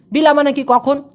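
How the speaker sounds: usual speaking voice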